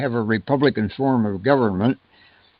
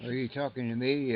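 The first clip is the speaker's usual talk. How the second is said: faint, much softer voice; too quiet; 12 dB quieter than usual. Real, not acted. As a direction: speak well under your usual volume. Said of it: "you gotta speak up a little".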